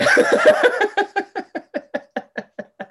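a long high burst of real laughter. Real, not acted. Friend cracks up over the taxi story, high laugh. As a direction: break up laughing, high and loud.

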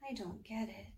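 very soft, private whisper. A narrator's reading. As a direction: murmur it under your breath, bewildered. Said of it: "she whispered".

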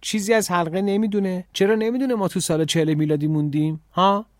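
high-pitched, indignant questioning; angry. A narrator's reading. Emma challenging the headmistress about the boy, angry. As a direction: fire off the questions in rising indignation. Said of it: "اما عصبانی شد و گفت".

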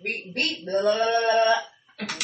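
tripping into babbled nonsense syllables; slurred "cursive" babble; tongue-tied. Real, not acted. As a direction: stumble over your words and give up in blahs. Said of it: "Trying to speak cursive again".

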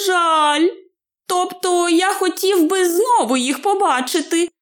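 high-pitched, drowsy hobbit voice; sleepy hobbit. A narrator's reading. Hobbit wishing to see the eagles again, sleepy high voice. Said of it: "мовив Більбо сонливим голосом".

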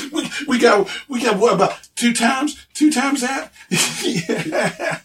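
high-pitched, amused guessing; laughing. Acted. Local guessing the town's population for visitors, laughing. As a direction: laugh through it, voice lifting high. Ogre jokes about the head count.

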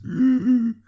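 loud, high wheeze. A narrator's reading. Bonnet reacting to the unmasked squire, loud wheeze. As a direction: wheeze noisily in shock. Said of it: "Bonnet wheezed".

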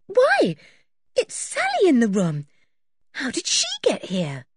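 put-on high, surprised girl's exclamation. A narrator's reading. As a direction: start very high and loud in surprise. Anne finds Sally in the room.

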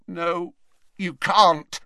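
frightened refusal. A narrator's reading. Malfoy refusing the headmaster's help, high and scared. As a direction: refuse with a shaking voice.

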